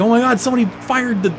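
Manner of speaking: acted-out "oh my God" panic; retold alarm. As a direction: blurt it out in rushed, high-pitched disbelief. Retelling his shock at the nuke.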